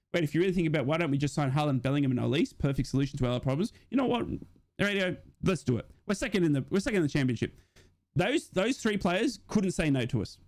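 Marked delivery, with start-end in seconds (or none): none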